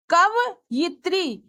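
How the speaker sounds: slow, syllable by syllable कवयित्री, ka-va-yi-tri; careful spelling demo. Shown as a demonstration. Teacher slowly sounding out कवयित्री for students. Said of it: "इनके शुद्ध वर्तनी इस प्रकार हैं".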